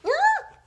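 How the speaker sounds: sudden high loud yelp; surprised shriek; startled cry. Real, not acted. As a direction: yelp as the note drops. Sticky note falls out.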